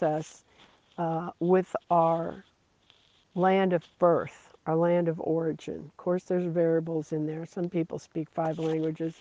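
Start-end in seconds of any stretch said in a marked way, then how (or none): none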